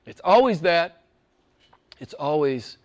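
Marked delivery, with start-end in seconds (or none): 2.0-2.8 much softer and lower, trailing away; hushed close. Acted. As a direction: let the last words sink to a hush. Closing words drop to hush.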